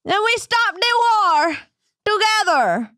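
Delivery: very high, hammed-up Jamaican accent; sustained high mock-Jamaican chant; bad Jamaican accent. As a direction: proclaim it in a terrible Jamaican accent. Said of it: "This might be the worst Jamaican accent ever".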